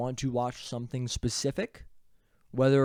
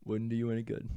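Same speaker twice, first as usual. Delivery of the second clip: action hero line; 3 dB quieter than usual. Acted. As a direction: deliver it like a movie hero. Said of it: "run back the bit".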